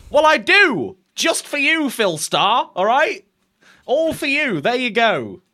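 high, loud comeback to a heckler; mock-defiant retort. Host answers a commenter complaining about his sign, mock-defiant. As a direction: fire it back loudly in a high, playful protest.

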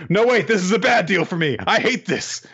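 high, whiny complaint about a bad deal; whiny Power. Podcaster retelling Power's complaint, high whiny voice. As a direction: pitch it up and whine like a greedy kid.

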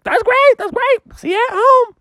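very high apologetic mother; high mom voice. Acted. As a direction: play the flustered mom up high. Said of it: "Froggy's mom was like".

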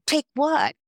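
high, loud retold disbelief; acted startled confusion. Student realizing she has been signed up, high acted surprise. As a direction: blurt the question as a replayed shock. Acts out her alarmed confusion.

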